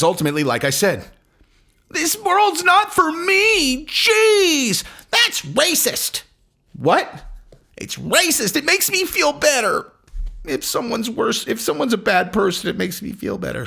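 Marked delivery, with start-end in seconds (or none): 1.9-9.9 very high, drawn-out mocking whine; mock a whiner in a squeaky put-on voice; whiny complainer. Comic mocking his critics for complaining, high whiny mock voice. 10.4-13.6 whiny complainer. Retold or imitated. Comic explains why they feel better, in mock whine.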